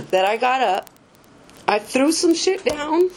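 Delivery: ordinary talk, nothing marked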